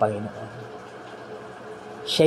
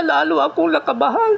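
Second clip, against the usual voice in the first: mock frightened. Sick man retelling his fear.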